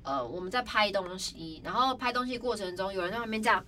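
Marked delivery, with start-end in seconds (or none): none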